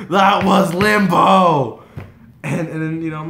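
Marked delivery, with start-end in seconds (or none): 0.1-1.8 high, loud, long-drawn yell; starstruck shout. Retold or imitated. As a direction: yell each word slowly in amazement. Retold fan freak-out after hug.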